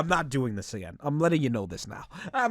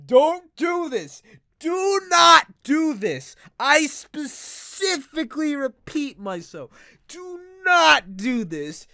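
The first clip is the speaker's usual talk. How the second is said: shouted warning; 10 semitones higher, 9 dB louder, slower than usual. Real, not acted. Drunk PSA warning viewers.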